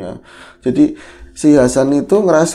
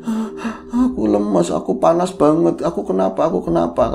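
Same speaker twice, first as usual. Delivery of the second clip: imitated feeble, higher-pitched complaint; weak moaning; 6 semitones higher than usual. Wife telling her husband she is limp and hot, mildly acted weak voice. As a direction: lightly act out feverish helplessness.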